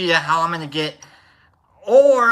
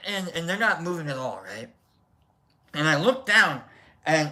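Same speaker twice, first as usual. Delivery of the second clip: usual speaking voice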